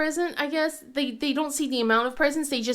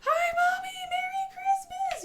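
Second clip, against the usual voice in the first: put-on much higher child voice, shouting with glee; excited kids. Kids run into mom's room, high gleeful shout. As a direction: yell it like kids bursting in on Christmas. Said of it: "ran into my room and go".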